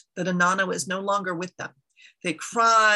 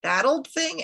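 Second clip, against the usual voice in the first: high-pitched incredulous question; surprised; 7 semitones higher than usual. A narrator's reading. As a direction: query it in raised, startled pitch. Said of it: "asked in surprise".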